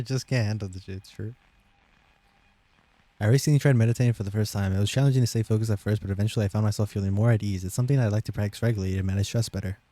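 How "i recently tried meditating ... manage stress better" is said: fast, hurried read-aloud; gabbling the test sentences at top speed; rushed read. Shown as a demonstration. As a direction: race through the paragraph as fast as possible. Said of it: "as fast as possible".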